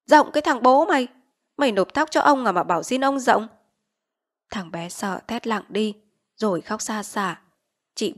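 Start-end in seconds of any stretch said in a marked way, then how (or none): 0.1-3.5 louder angry retort; chief's snarl. Acted. Village chief rebuffing Vít's plea for leniency, angry shout. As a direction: snap back at her plea. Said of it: "Hắn quát".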